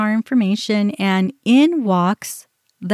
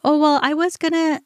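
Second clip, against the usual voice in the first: higher-pitched imitation of an excuse; retold protest in a raised pitch; mock arguing; 8 semitones higher than usual. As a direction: mimic her starting to argue, pitched up. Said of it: "she started arguing".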